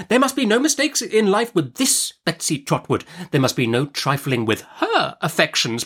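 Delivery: high-pitched, emphatic, determined aunt; imperious Miss Betsy. A narrator's reading. Aunt vows to be the girl's godmother, imperious. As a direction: lay down the law for the child.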